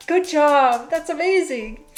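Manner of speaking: high, loud, over-the-top "wow, good job"; mock gushing praise. Mocking how people praise a crying man with big fake awe. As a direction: gush like an impressed crowd. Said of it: "the way society responds to that is like".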